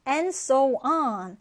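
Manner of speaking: And so on drawn out very slowly; very slow model phrase. Shown as a demonstration. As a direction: stretch every word for the class. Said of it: "다음 표현 같이 보겠습니다". (아주 천천히)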